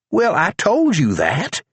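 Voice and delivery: deputy reminding the prisoner; Charlie's voice. A narrator's reading. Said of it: "Charlie said".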